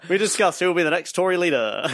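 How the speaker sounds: trailer voice. Pretend. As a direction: hype it like a promo. Mock trailer voice continues.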